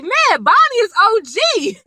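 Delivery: high, stretched-out admiring exclamation; impressed amazement. Retold or imitated. Fan hyping up Bonnie, impressed amazement. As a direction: draw it out high, hugely impressed.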